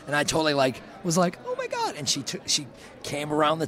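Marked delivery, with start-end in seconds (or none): 1.5-1.9 tiny, very high fangirl squeak; starstruck squeal. Retold or imitated. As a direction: squeak it softly in mock overwhelm. Retold starstruck fan reaction.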